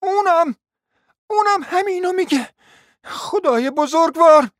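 high, loud despairing cry. Acted. Hostess crying out in despair at the agreement. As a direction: wail it out to heaven. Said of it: "فریاد برآورد".

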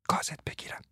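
whispered playful threat. Acted. Martha whispering a playful threat to Frans. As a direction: hiss it softly.

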